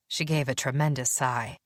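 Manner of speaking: ordinary delivery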